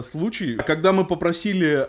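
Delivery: ordinary delivery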